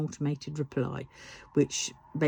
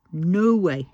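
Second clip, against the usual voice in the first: an exasperated louder retort; 3 semitones higher, 7 dB louder than usual. Real, not acted. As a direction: punch the repeat with real anger. Repeats phrase, second louder.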